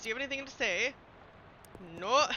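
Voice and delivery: usual speaking voice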